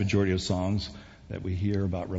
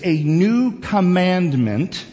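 drawn-out, higher, deliberate reading; slow scripture reading; 8 semitones higher, 7 dB louder, much slower than usual. Real, not acted. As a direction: read slowly, weighing each word. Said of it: "I'm gonna read it slowly".

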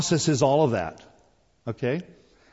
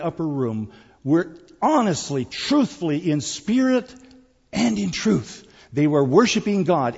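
raised, louder preacher's voice; full-force preaching; 4 semitones higher, 4 dB louder than usual. Real, not acted. Preacher urging the congregation to truly worship, full-force preaching. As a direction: drive each phrase home high and loud.

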